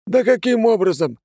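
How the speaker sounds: high exasperated yell. A narrator's reading. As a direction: shout it in frustration. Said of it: "заорал кто-то из них".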